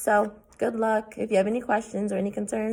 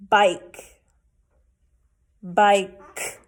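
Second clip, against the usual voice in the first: each word said loud and clearly; teacher's model words; 4 dB louder, much slower than usual. Shown as a demonstration. Teacher modelling words for kids, loud and clear. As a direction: say each one big for the class. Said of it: "What's my ending sound?".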